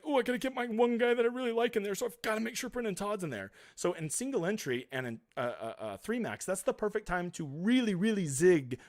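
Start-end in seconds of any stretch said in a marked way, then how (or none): 0.0-3.5 higher-pitched imitation of the crowd; raised, mocking quote voice; mock everybody voice. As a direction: mimic an eager player, pitched up. Voicing what everybody else thinks.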